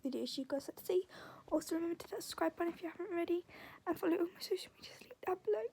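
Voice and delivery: fast whispered sign-off. Real, not acted. Whispering outro.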